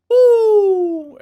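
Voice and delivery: loud, high, ringing owl hoot; piercing high "hoo" held out; metallic owl hoot. Acted. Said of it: "it's almost a metallic sounding hoo".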